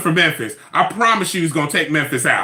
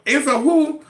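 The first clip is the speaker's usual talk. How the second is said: mock indignation; 10 semitones higher than usual. Real, not acted. Mocking indignation.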